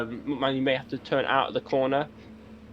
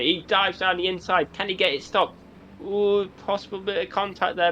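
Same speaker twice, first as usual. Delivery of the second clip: excited; 7 semitones higher than usual. Real, not acted. Close battle, contact on track.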